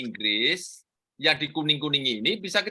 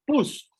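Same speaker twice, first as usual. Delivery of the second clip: sharp higher push like a sound effect; mock push sound; 11 semitones higher than usual. Teacher playfully voicing a button press for students. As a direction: pop the word out like a button click. Mimicking software loading.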